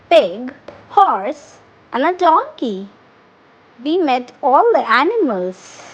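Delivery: ordinary talk, nothing marked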